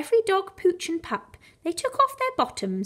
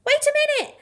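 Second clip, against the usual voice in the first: much higher, louder urgent call; 14 semitones higher, 6 dB louder, much faster than usual. Acted. Clive halts the panicking mutts, high and loud. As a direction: stop them in their tracks. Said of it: "said Clive to the panicking mutts".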